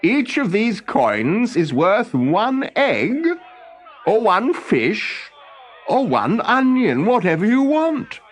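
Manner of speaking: high, slow, eager explaining inventor. Acted. Olaf explaining coin worth to Thornogson, high and slow. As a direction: spell it out high and keen.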